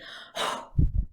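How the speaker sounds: sharp voiceless puff of air; short breath out. Shown as a demonstration. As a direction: blow the air out in one short burst. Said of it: "do a very short breath out".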